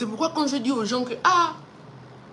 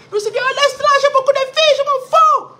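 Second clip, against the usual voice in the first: very high, loud dismissive yell; father's furious shout; 11 dB louder than usual. Retold or imitated. Father yelling he doesn't care, high and loud. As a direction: bellow it like the angry father. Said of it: "quand il dit ça".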